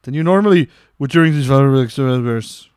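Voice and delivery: booming, mock-dramatic read; loudly projected voice. Shown as a demonstration. Reader acting out advice on outbursts, loudly projected. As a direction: belt out the instructions, leaning on key words. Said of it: "Project your voice loudly".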